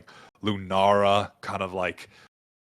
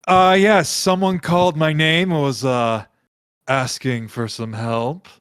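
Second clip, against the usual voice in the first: breezy god; 6 semitones higher, 5 dB louder than usual. Acted. Game master voices Jin.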